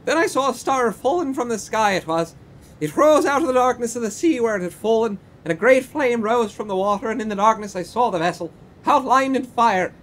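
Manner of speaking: high, loud, hammed-up Irish brogue; drunken Irish accent. Old porter tells police of the burning ship, loud Irish accent. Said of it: "need that accent".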